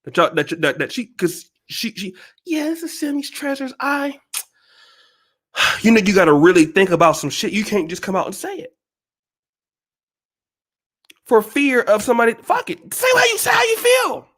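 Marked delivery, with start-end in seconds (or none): 2.4-4.2 higher, softer imitation of a hesitant woman; Sammy's Treasures. YouTuber afraid of her viewers' reaction, timidly imitated. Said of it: "but she afraid that y'all gon". 12.3-14.3 high-pitched exasperated holler; urgent outburst. Acted. Urging a hesitant YouTuber to speak up, high exasperated outburst.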